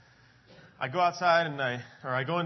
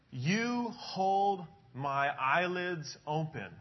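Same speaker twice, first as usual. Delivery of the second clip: slow, deliberate reading of the verse; slow scripture reading; much slower than usual. Retold or imitated. Pastor reading the verse aloud to the congregation, slow and deliberate. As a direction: read it out word by word, very slowly. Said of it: "It says".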